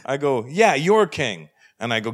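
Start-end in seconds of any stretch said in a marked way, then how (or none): 0.5-1.5 high, drawn-out retort; emphatic retort. Acted. Comedian to interrogator about his king, emphatic retort. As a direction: stretch it out at him, slowly.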